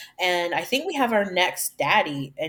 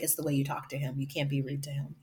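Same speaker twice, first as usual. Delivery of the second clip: calm explanation; 4 semitones lower, 6 dB quieter, faster than usual. Retold or imitated. Friend calmly explains his trick.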